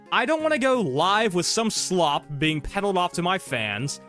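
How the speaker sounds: usual speaking voice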